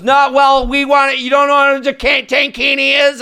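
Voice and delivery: high, insistent mimicry of a heckler; imitating a protesting audience member; mock heckler. Acting the drunk heckler arguing back.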